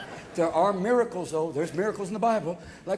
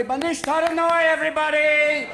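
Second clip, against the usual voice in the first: raised voice, hurrying a crowd along; rallying call; 4 semitones higher, 4 dB louder than usual. Acted. Leader rushing the Hebrews out of Egypt, rallying call. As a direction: holler to round everyone up.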